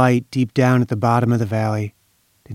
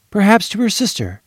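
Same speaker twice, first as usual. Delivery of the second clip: higher, lifted wondering tone; wondering question; 8 semitones higher than usual. A narrator's reading. Narrator wondering aloud who the child whispered to, lifted. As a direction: toss out the first guess. Same words said twice.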